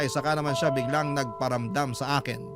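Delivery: usual speaking voice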